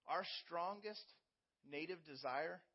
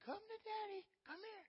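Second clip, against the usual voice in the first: put-on much higher, soft baby-talk coaxing; sing-song call to a toddler taking first steps; coaxing daddy; 3 dB quieter than usual. Father coaxing his toddler to walk to him, in high soft baby talk. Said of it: "and you go over there".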